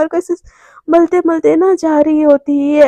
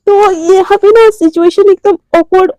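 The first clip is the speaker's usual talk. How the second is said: ordinary delivery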